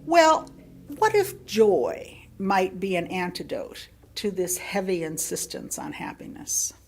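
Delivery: normal talking voice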